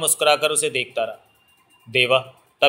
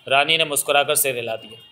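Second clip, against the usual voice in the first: usual speaking voice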